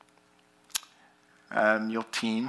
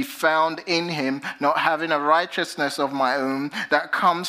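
raised, measured Bible reading voice; scripture reading; 5 semitones higher, 3 dB louder than usual. Acted. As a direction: read it out higher, like from the pulpit. Reads scripture aloud.

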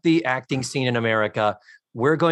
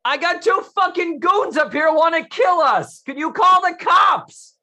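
high, loud hammed-up yell; shouting for help; 6 dB louder than usual. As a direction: holler down to strangers for rescue. Voicing trapped character shouting for help.

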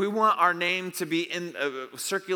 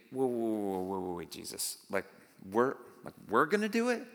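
a disciple caught off guard, realizing the job is his; stunned disciples; 5 semitones lower than usual. Retold or imitated. Preacher voicing stunned disciples, unbelieving. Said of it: "imagine they're thinking".

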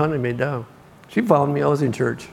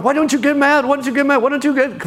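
mock nagging woman; 10 semitones higher, faster than usual.

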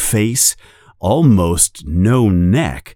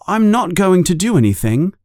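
higher, fast earnest denial; protesting boy; 10 semitones higher, faster than usual. A narrator's reading. Harry assuring his uncle, quick and earnest. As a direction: rush to promise.

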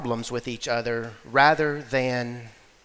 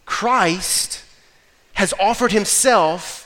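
louder, higher gospel preaching; fiery preaching; 5 semitones higher, 4 dB louder, slower than usual. Acted. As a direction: preach it with rising fervor. Preaching builds to full force.

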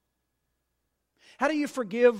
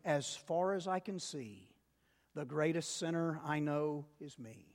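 soft reflective lead; 4 semitones lower, 7 dB quieter than usual. Shown as a demonstration. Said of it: "Y'all say that with me".

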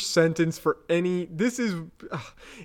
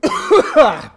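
sudden coughing fit; choking cough; 12 semitones higher, 9 dB louder than usual. Real, not acted. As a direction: cough on a drink. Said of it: "Sorry, I just choked on my drink".

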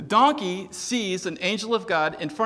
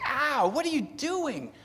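higher, drawn-out mock "ow" and complaint; exasperated yelp; 6 semitones higher, slower than usual. Rider scolding the donkey that squashed his leg, frustrated. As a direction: act out pained frustration, easing off toward the end. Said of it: "he gets all frustrated more".

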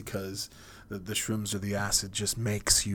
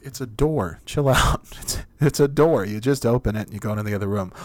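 louder, impatient, rushing at the end; exasperated; 8 dB louder, faster than usual. Acted. Friend telling the panicked one to chill out, loudly exasperated. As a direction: snap them out of it.

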